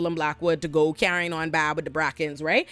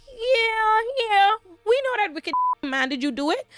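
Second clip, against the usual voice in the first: mock high sneer; 13 semitones higher than usual. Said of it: "And Tully was like".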